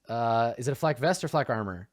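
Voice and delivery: ordinary talk, nothing marked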